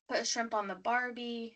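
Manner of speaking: soft mock Aussie catchphrase; lightly put-on Australian accent. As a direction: quietly imitate an Australian. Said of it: "any ideas for Australia?".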